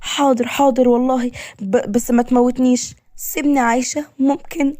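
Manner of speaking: high-pitched frightened pleading; fearful, trembling. Acted. Woman begging her kidnapper to spare her, trembling with fear. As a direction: plead for your life, halting and high. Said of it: "وهي بخوف وهي بتترعش".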